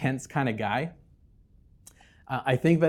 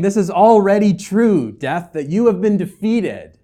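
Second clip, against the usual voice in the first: loud, higher taunt to death; 7 semitones higher, 9 dB louder than usual. Acted. Paul telling death it is beaten, loud taunt. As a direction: throw the defeat at death. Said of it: "He's saying".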